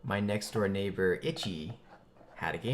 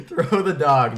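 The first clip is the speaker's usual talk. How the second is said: loud words through laughter; giggling slip; 1 laughing; 5 semitones higher, 13 dB louder, slower than usual. Real, not acted. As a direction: say it while cracking up.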